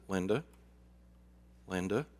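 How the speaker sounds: soft, quiet calling of her name. Retold or imitated. Pastor calming a screaming woman, soft and hushed. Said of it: "I spoke in a very soft voice".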